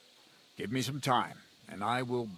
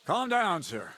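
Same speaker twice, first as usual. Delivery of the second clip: raised voice, calming a quarrel; loud; 7 semitones higher, 5 dB louder than usual. Acted. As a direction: call over them to calm down. Stepping in to stop the quarrel.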